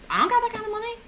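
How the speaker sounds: high-pitched disbelieving protest; indignant protest. Acted. Broke patient answering a surprise bill, indignant. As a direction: protest it high and fast, aghast.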